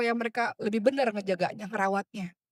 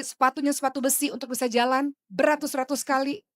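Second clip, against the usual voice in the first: ordinary delivery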